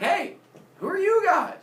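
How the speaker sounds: high, loud hey; called out loud and high; calling down. Acted. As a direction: shout down into the pit. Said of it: "He's like".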